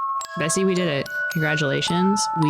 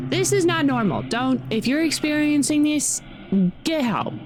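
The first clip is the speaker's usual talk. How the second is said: higher, urgent insistence; emphatic; 9 semitones higher than usual. Real, not acted. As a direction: plead with them to get help. Emphatic warning to viewers.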